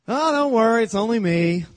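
Jesus calling to the boat, loud and reassuring; calling out reassurance. Acted. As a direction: call out to calm the frightened men. Jesus calms terrified disciples.

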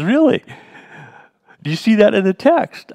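high, loud mock disbelief from the mentor; incredulous challenge. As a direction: imitate him pushing back, surprised and doubting. Quoting the mentor's surprised challenge.

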